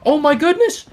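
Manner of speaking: excited shout. Real, not acted. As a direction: scream it as the play explodes. Caster erupts at wild play.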